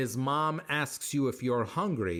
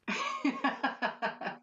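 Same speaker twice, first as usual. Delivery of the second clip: breathy laugh; 9 semitones higher, 3 dB quieter than usual. Real, not acted. Laughing at own punchline.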